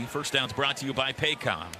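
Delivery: usual speaking voice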